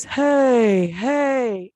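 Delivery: loud, drawn-out dad hey; imitated dad, swooping and commanding; big dad voice. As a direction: boom it like dad hushing a baby. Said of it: "with this big dad, deep voice".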